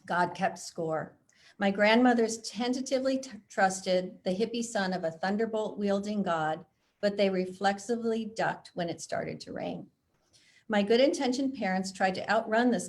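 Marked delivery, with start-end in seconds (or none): none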